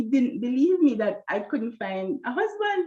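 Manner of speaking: ordinary delivery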